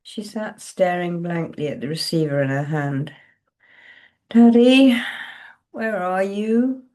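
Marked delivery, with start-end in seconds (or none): 4.3-6.8 soft cry. A narrator's reading. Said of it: "She cried out softly".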